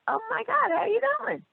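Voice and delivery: high gushing greeting; mock gushing. She greets the patient back in a high gushing voice. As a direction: pitch it way up, overly friendly. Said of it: "I'm like".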